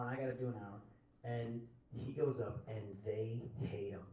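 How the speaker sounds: usual speaking voice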